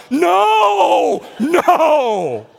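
loud, high drawn-out protest, then falling; mock horrified refusal. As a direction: wail it as exaggerated dismay. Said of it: "Some of you are like".

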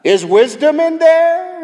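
very high, loud singsong holler; calling into cave. Acted. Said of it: "standing at the mouth of the cave saying".

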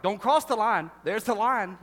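put-on loud bossy kid shouting; kid yelling. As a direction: yell it like a bratty sibling guarding the seat. Kids squabbling over seat line.

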